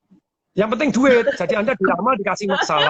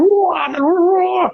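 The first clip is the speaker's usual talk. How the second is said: high, loud startled "wah"; suddenly spot the children running; surprised cry; 11 semitones higher, 5 dB louder, much slower than usual. Acted. Mimics alarm at running child.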